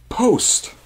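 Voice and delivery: high, sharp military call of post; sharp barked command. Retold or imitated. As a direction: bark it like a first sergeant. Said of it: "the first sergeant goes".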